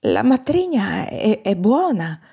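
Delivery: hesitant, slow, faltering reassurance; trembling voice. A narrator's reading. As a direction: falter mid-sentence with a slight tremble. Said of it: "ma con voce tremante".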